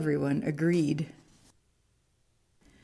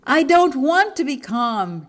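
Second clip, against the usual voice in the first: high, loud storybook shout; 8 semitones higher, 7 dB louder than usual. A narrator's reading. Monkey fed up with calming advice, shouting. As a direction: burst out in loud protest. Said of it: "Jim shouted".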